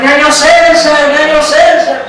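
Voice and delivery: slow, drawn-out call of a name down the street. Retold or imitated. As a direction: holler after someone across the street. Stranger shouting name in street.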